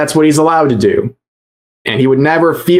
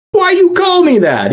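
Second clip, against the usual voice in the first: much higher, hammed-up offended voice; mock protest. Host mocking a streamer called a terrorist supporter, hammed-up protest. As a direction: imitate an aggrieved complainer, pitched way up.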